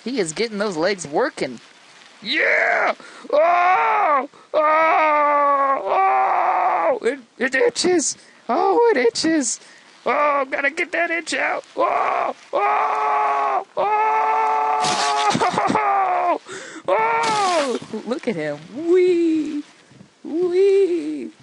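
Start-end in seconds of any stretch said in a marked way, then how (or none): 2.2-7.0 loud, high drawn-out yells; high, sustained mock-ecstatic howl; hammed-up howling. Hammed-up shouting at game antics. 7.0-11.6 high, hammed-up complaint of torment; mock agony. Acting out an unbearable itch. 11.8-17.8 long, high sustained yell; high mock-agony howl, held long; hammed-up howl. Acting out an unbearable itch. 18.8-21.3 high, drawn-out joyride wee; playful squeal. Pretend. Voicing game character's playful motion.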